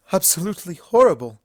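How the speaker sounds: a theatrical shudder in her voice; shuddering Rarity. A narrator's reading. Rarity reacting to the tale, shuddering.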